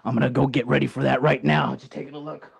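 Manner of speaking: ordinary talk, nothing marked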